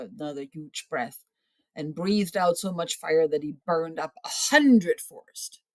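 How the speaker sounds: plain reading voice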